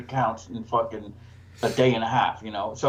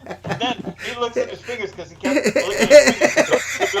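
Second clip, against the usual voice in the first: high and loud, giggling through every phrase; words squeezed out between laughs; laughing through words; 9 semitones higher, 6 dB louder than usual. Real, not acted. Still cracking up over dad's blue fingers, laughing.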